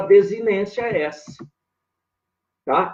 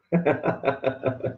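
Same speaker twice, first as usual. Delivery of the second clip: quick breathy run of chuckles; 2 semitones lower, 5 dB quieter than usual. Real, not acted. Teacher greeting a student from back home, amused chuckle.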